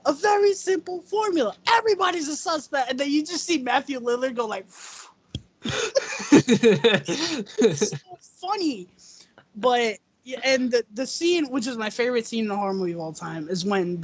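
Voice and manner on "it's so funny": giggling it out slowly; cracking up; laughing. Real, not acted. Laughing about Lillard.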